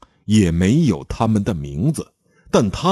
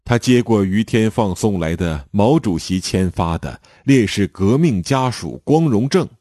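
usual speaking voice

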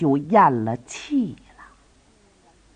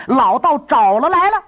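monk's report; 8 semitones higher, 4 dB louder than usual. Acted. Monk reports to Ouyang Gong.

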